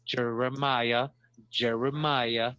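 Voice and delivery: 慢慢拉长念出名字; drawn-out name. Shown as a demonstration. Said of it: "Is that a French name?".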